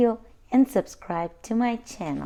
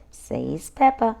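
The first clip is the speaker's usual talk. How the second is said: ordinary delivery, nothing marked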